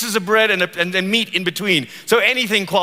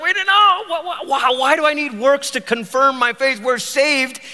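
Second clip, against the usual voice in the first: mock alarm; 7 semitones higher than usual. Mock protest to James.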